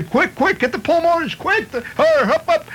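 shrill, hurried shouts of quick; frantic urging. Acted. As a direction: hustle the crew like mad. Firemen urging each other.

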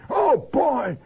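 high, loud chest-beating gusto; hammed-up morning zeal easing into a chuckle; mock-hearty enthusiasm. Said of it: "beats your chest and says".

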